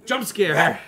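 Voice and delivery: sudden loud burst; jump scare. Pretend. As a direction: blast it out to startle. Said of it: "No, I'm kidding".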